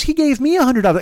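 high-pitched, rapid mimicked protest; indignant protest. Passenger claiming the money was theirs, indignant protest. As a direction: imitate an arguer insisting, high and quick. Passenger argues back in quarrel.

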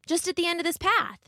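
put-on high, polite little girl voice; a small girl minding her manners. Little Red thanking the wolf, high and polite.

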